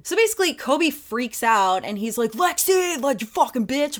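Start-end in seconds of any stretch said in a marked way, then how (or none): 2.3-4.0 mock angry outburst. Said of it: "that's not what he says".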